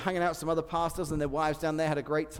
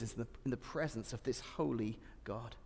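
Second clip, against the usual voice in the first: ordinary talk, nothing marked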